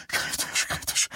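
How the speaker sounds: breathy whispered moan; mother moans the word twice, whispered. Acted. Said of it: "stöhnte Vera".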